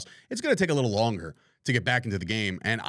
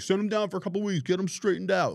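higher-pitched mimicking of fans; insistent fans; 7 semitones higher than usual. Host quoting people who want the kid sent down, mock-insistent. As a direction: imitate pushy armchair managers, pitched up. Quotes fans demanding a demotion.

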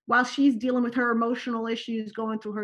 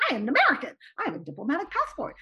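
entitled American; 6 dB louder than usual. Retold or imitated. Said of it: "I was like".

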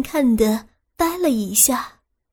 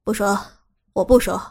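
low, deadpan refusal; expressionless refusal; 5 semitones lower, faster than usual. A narrator's reading. Luo Qiang refusing to tell his friend, expressionless. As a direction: refuse tonelessly in a lowered voice. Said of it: "面无表情地说道".

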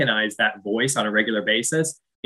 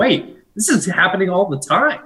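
higher, louder retold double take; startled realization; 9 semitones higher, 4 dB louder than usual. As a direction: catch yourself mid-thought, surprised. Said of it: "I was like".

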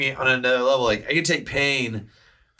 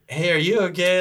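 higher-pitched concerned question; imitated girlfriend; 7 semitones higher than usual. Girlfriend phoning to ask if he's okay, lightly imitated higher voice. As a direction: lightly imitate a woman checking in. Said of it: "call from my girlfriend at the time".

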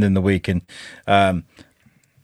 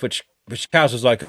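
usual speaking voice

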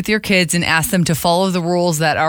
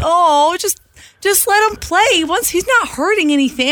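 pleading; 11 semitones higher than usual. Retold or imitated. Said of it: "I'm like".

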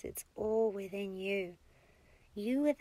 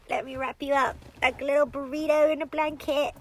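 high, loud, playful cooing; playful fussing; 5 semitones higher, 10 dB louder than usual. Acted. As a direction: bundle them up with bubbly, teasing energy. Playfully bundling partner in blanket.

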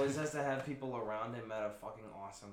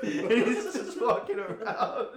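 talking through laughter, higher; real laughter while describing; laughing; 9 semitones higher, 12 dB louder than usual. Real, not acted. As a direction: giggle through the words.

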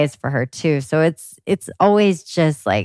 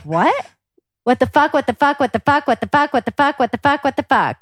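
high, repeated stunned disbelief, much higher; shocked mom; 9 semitones higher than usual. Retold or imitated. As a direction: rattle it off again and again in shock. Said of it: "I was like".

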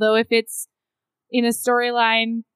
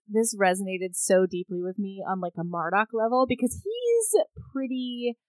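normal talking voice

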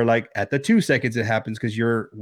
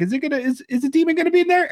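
scared squeak; much faster than usual. Retold or imitated. Scared viewer in horror movie.

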